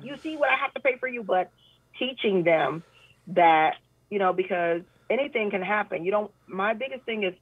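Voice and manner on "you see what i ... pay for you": high, fast mock parent scolding over bills. Parent to child about the bills, mock nagging voice. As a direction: rattle it off as a nagging parent, pitched up.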